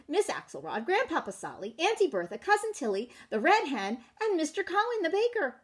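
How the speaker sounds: usual speaking voice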